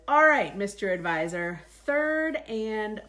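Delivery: loud, slow, drawn-out buildup; milk the drama of the last one; booming king, slow and loud; loud royal announcement. Acted. King building to final question.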